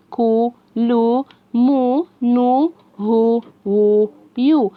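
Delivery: slow chant of long, held syllables; letter-sound drill. Shown as a demonstration. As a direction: hold each sound evenly, pausing between them. Teacher recites letters with damma.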